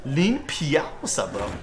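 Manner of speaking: badly faked French accent; horrible French accent. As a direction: say the name in hammed-up bad French. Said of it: "my horrible French accent".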